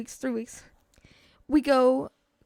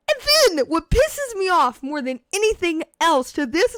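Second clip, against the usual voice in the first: slow, higher emphatic complaint; exasperated rant; 4 semitones higher, 4 dB louder than usual. Real, not acted. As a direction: stretch it out in heated exasperation. Ranting about what angers him.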